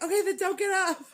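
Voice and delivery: loud, high retold nurse's reply; mock urgent shout. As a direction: shout it high, as a joking order.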